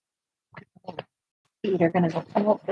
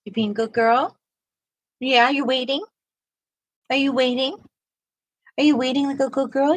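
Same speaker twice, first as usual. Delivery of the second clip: talking to a dog; 6 semitones higher than usual. Real, not acted. High praise to the dog.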